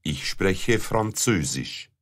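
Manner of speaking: ordinary delivery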